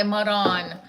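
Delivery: ordinary talk, nothing marked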